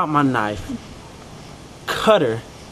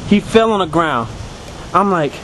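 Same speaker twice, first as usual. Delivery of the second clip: ordinary delivery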